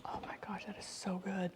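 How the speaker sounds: breathy near-whisper after tasting; hushed delight. Real, not acted. Tasting soup on stream, near-whispered delight. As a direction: whisper your awe at the flavor.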